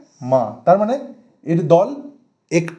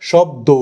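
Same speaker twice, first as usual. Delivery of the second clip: "শব্দ" with the last o held open; continuing final vowel; 2 semitones lower, much slower than usual. Shown as a demonstration. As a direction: let the end of "শব্দ" carry on. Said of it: "দেখুন continue হচ্ছে শব্দটা". ("শব্দ" শেষের স্বর টেনে)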